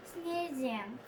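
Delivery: plain reading voice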